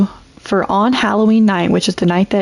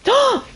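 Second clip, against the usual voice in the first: sudden high shocked gasp; sharp gasp. Real, not acted. Gaming death reaction gasp.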